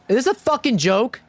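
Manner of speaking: high-pitched exasperated outburst; fed-up gamer snapping at the game; frustrated. Real, not acted. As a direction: burst out in tilted disbelief. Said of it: "I'm getting frustrated".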